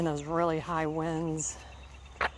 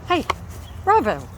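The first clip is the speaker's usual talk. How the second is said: sharp raised call to the dog; scolding dog; 7 semitones higher, 5 dB louder than usual. Real, not acted. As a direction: snap at the dog to stop.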